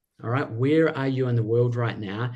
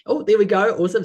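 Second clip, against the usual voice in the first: bright burst of delight, higher and louder; excited; 8 semitones higher, 7 dB louder, faster than usual. Real, not acted. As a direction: cheer as a welcome reply pops up. Host spots chat replies.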